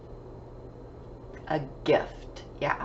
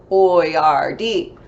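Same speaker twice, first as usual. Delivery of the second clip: put-on "Boyardee" in three punchy, separate beats; syllable by syllable; 5 semitones lower, 5 dB louder than usual. Shown as a demonstration. Teacher reminding pupils of three syllables, "Boyardee" broken into beats. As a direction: clap out each syllable of "Boyardee", louder. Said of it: "Boyardee had three syllables".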